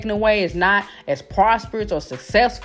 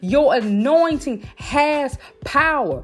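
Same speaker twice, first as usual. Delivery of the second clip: stirred-up preaching; 4 semitones higher than usual. Real, not acted. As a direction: preach it home, building to a fever. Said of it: "Y'all done got me stirred up now".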